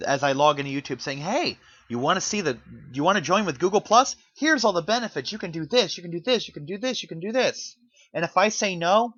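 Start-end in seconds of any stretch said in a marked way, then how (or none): 1.2-7.8 high-pitched mock promotional spiel; imitated sales pitch. Pop-up pitching Google Plus to a user, mock sales pitch. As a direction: lightly play the eager pop-up, rattling off perks.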